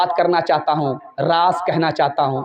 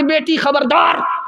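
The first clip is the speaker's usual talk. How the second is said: higher, slow shouted warning; mother's warning shout; 8 semitones higher, slower than usual. Acted. Mother warning her daughter, slow shouted caution. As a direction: stretch out a stern shout of alarm.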